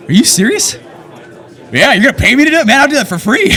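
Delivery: high, loud, thrilled reaction to a dream job offer; excited disbelief. Retold or imitated. Accepting the job call, excited and loud.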